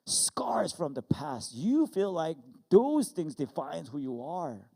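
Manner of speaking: loud, high, slow emphatic delivery; impassioned preaching. Real, not acted. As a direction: preach it out with full force. Preaching at full force.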